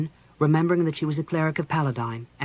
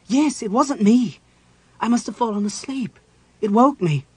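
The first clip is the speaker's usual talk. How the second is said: high, louder woman's voice; startled woman; 8 semitones higher, 7 dB louder than usual. Acted. Chrysania answering Caramon after the scream, startled. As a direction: play her just woken and startled.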